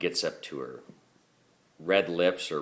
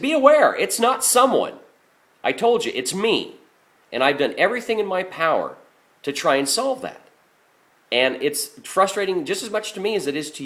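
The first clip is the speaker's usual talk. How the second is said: much higher and louder, frustrated plea; frustrated outburst; 10 semitones higher, 8 dB louder than usual. Retold or imitated. Garbage man to the complaining customer: frustrated, loud plea. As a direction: pour out your frustration, voice pushed high.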